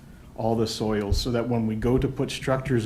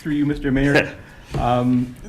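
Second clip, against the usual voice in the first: laughing start; 3 semitones higher, 6 dB louder than usual. Real, not acted. Engineer answers, still laughing.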